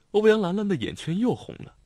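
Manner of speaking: usual speaking voice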